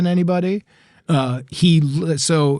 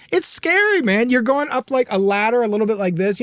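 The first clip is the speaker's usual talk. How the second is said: mock scared; 6 semitones higher than usual. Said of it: "I would be like".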